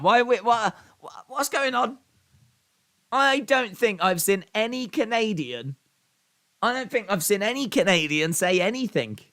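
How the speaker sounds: high, loud bewildered disbelief; can't believe what he's reading in chat; baffled. Real, not acted. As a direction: streamer stunned by fans turning on Canada.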